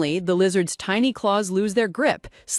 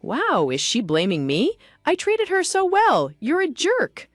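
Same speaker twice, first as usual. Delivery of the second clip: rising, pitch jumps higher on the complaint; indignant inner grumble, much higher at the end; annoyed frustration; 7 semitones higher than usual. A narrator's reading. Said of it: "annoyed Erin to think in frustration".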